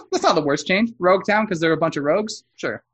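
normal talking voice

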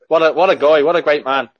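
ordinary delivery

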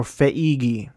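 Feige said as feh-EE-ghee; alternate pronunciation. Shown as a demonstration. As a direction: split Feige into three syllables, "feh-EE-ghee". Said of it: "or Feige".

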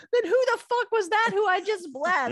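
high-pitched mock panic; shaken, distraught. Isaac learns he blessed the wrong son, shaken. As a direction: blurt it high in acted alarm. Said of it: "Isaac starts shaking and says".

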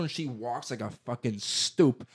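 ordinary talk

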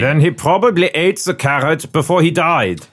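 put-on German accent. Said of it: "my German accent's been very good".

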